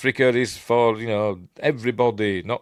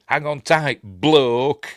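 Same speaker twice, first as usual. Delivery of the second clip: urgent mate; 6 semitones higher, 7 dB louder, much slower than usual. Retold or imitated. Voicing film character line.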